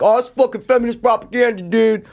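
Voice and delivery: high, mock-outraged big brother rant; mocking older brother. Podcaster mocks his older brother raging at the movie, high mock outrage. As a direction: ham up a fed-up brother complaining about feminism. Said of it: "like maybe my older brother would be like".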